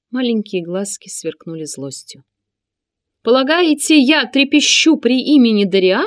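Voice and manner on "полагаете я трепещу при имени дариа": high, loud scornful sneer; contemptuous. A narrator's reading. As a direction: sneer at the threat. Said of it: "презрительным тоном произнёс Синан".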